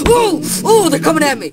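high loud startled yelp. Real, not acted. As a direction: yelp as they charge. Mobs rushing at him.

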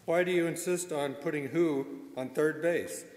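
normal talking voice

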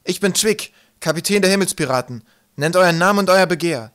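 loud, high captain's proclamation; calling out. A narrator's reading. Twig as pirate captain challenging the stranger, calling. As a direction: demand it with a commander's authority. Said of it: "rief er der Gestalt zu, wie Wolkenwolf".

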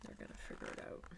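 quick hushed whisper. Real, not acted. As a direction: rush it out in a whisper. Whispered aside to listener.

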